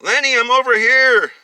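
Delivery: very high, loud sustained call; long high cry across a big space; screaming for help. A narrator's reading. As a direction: scream so a rescuer can find you. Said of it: "he heard her screaming".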